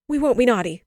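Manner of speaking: loud, high, urgent promise; cried out. A narrator's reading. Children beg mother to stay, crying out. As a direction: promise loudly like frightened children. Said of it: "they cried".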